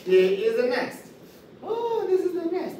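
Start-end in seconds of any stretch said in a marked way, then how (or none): 1.6-2.8 excited reveal. Acted.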